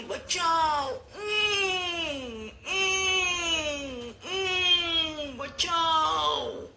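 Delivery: loud crying shout for help. A narrator's reading. Trapped lion shouts for help, crying and loud. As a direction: wail for rescue, trapped and desperate. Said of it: "સિંહે તો બૂમ પાડી".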